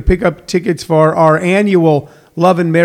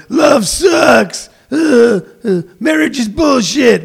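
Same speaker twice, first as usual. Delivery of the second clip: higher, shouted bitter grump; bitter angry rant; 7 semitones higher, slower than usual. Pretend. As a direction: spit it out like a sour cynic. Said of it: "bitter or angry person going".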